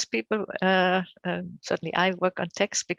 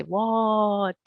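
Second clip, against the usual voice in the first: "What?" with a long stretched vowel, a bit raised; drawn-out WHAT; 3 semitones higher, 4 dB louder than usual. Shown as a demonstration. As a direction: stretch the vowel in "What?" as if texted with many A's. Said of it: "with many A's".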